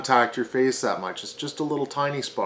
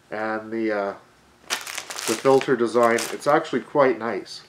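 muffled behind cloth mask; speaking through valved mask; through a face mask; 4 dB louder than usual. Real, not acted.

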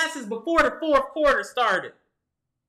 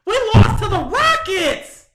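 furious shout; 9 semitones higher, 8 dB louder than usual. Real, not acted. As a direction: yell it out in disbelief, loud and high. Fan ranting after Bucks loss.

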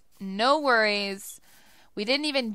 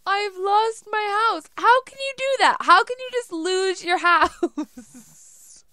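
hammed-up wail; 8 semitones higher, 5 dB louder than usual. Lost house, wailing.

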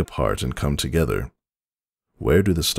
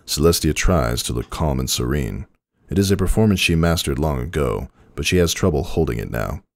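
usual speaking voice

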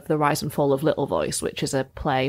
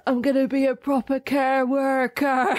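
mock Lancashire accent, pitched up; a light Lancashire accent, imitating the actor; 7 semitones higher than usual. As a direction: quote it in a broad Lancashire accent. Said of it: "his accent is great in the show".

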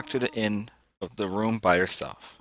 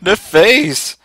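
high and loud, laughing at the face; amused outburst; 11 semitones higher, 8 dB louder, slower than usual. Real, not acted. Gamer yelling at the monster's face, excited. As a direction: cry out at the ugly face.

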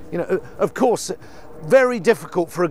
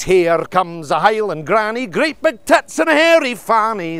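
put-on Scottish accent; 2 semitones higher, 4 dB louder than usual. Bawdy Scottish rhyme in accent.

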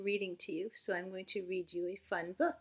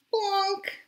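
high, louder plonk; 12 semitones higher, 9 dB louder than usual. Acted. Teacher voicing Norman landing, comic plonk. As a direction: pop the landing sound.

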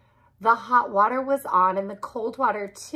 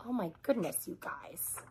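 near-whispered "oh my goodness"; quiet aside; 2 semitones lower, 15 dB quieter than usual. Real, not acted. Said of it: "reader's own aside".

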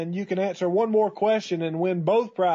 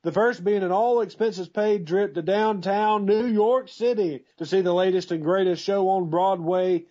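big game show prize read; booming prize announcer. Acted.

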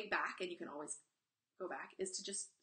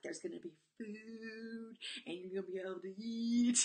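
playful teasing; 3 semitones higher, 5 dB louder than usual. Pretend. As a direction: tease him playfully with a silly voice. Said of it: "I'm playing with him".

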